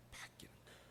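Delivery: a breathy hushed whisper. Acted. Preacher whispering to the congregation that Jonah's heart changed. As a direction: drop to a secret whisper for the turn.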